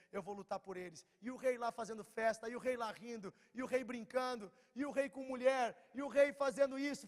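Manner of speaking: loud, high, impassioned sermon delivery; full-force preaching. Acted. Pregação em plena força. (pregação inflamada, voz alta e aguda)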